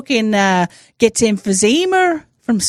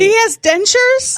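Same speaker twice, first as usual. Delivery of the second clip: high astonished question; surprised; 10 semitones higher than usual. Real, not acted. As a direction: say it in shock. Shocked at Biden's dentures.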